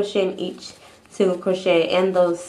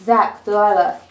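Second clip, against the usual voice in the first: calling the dogs, raised voice; 7 dB louder than usual. Real, not acted. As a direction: call out to the pets in the room. Calling her dogs by name.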